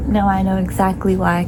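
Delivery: ordinary talk, nothing marked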